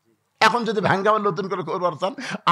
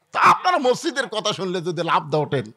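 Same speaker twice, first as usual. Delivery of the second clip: ordinary talk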